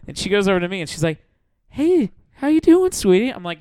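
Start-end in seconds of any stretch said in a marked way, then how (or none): 1.7-3.3 higher-pitched, lightly voiced come-on; imitated sugary stripper; flirty stripper. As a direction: coo a mock-sweet greeting. Stripper's flirty approach, acted.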